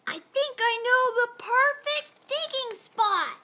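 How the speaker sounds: put-on excited boy offering help; eager child. A narrator's reading. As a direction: pitch the idea eagerly. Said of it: "I shouted over the wind".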